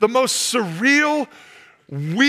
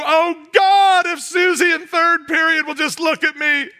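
mocking a teen praying for a crush; mock teen prayer; 5 semitones higher than usual. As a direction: beg like a lovesick teenager.

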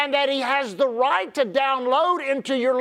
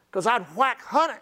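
usual speaking voice

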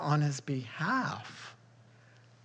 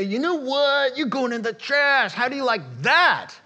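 loud, high, gloating scolding; mock telling off a faucet; 8 semitones higher, 7 dB louder than usual. Pastor mock scolding the leaky hose faucet, loud and high. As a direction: tell the stripped faucet it's going in the trash. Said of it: "and say".

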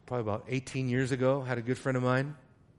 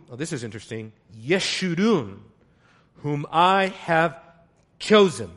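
preaching scripture; 5 dB louder than usual. Acted. Reads Isaiah 44 declaiming.

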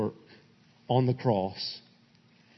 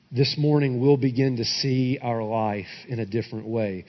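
low, subdued pastoral prayer; measured, reverent prayer, settling lower at the end; closing prayer; 3 semitones lower than usual. Real, not acted. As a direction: lead the congregation gently in prayer.

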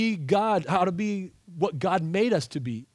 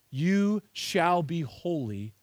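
solemn slow reading; much slower than usual. Shown as a demonstration. Reading God's words slowly.

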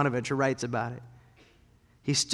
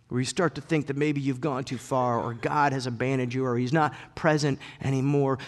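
normal talking voice

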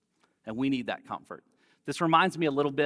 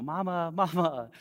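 high, mock-tearful "Mama, Mama"; lightly imitating a crying little girl; crying toddler; 5 semitones higher than usual. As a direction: call for mom like a toddler. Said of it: "the tears increase".

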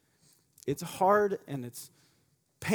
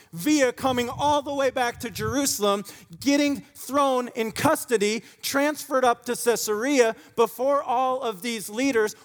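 fervent preaching; 7 semitones higher, 6 dB louder than usual. Acted. Preaching at full force.

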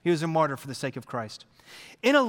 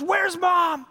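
put-on high shouted child's question; child shouting; 12 semitones higher, 4 dB louder than usual. Daughter shouting for mom after the throw.

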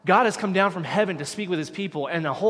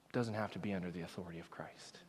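whisper; 9 semitones lower, 13 dB quieter than usual. Retold or imitated. Quoting a selective believer.

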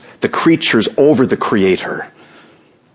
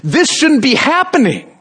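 mock angry whine. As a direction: drag it out like a sulking complainer. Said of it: "when we angrily say".

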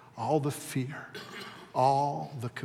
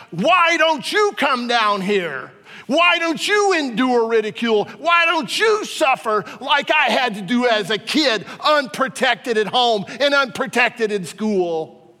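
crying out at God; 10 semitones higher, 10 dB louder than usual. Retold or imitated. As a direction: hurl the questions up to heaven. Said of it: "I was crying out".

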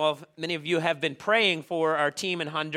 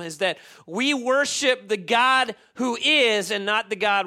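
raised, emphatic preaching voice, higher and driving; fervent preaching; 5 semitones higher, 3 dB louder than usual. Acted. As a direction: preach it to the congregation with full conviction. Preaching at full force.

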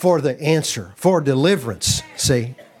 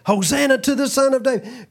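put-on children's praise; 10 semitones higher than usual.

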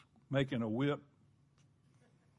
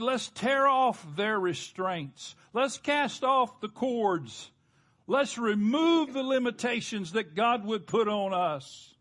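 higher, slow rallying cry; defiant rebels; 6 semitones higher, 4 dB louder than usual. Acted. Preacher quoting rebels against God, acted defiant cry. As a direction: voice rebels urging each other on, pitched up.

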